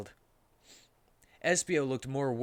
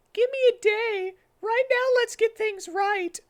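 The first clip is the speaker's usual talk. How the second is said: put-on squeaky high girl voice, louder; high Amy voice; 8 dB louder than usual. Amy tells Sonic about Shadow, squeaky high voice. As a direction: pitch way up as Amy, shaken.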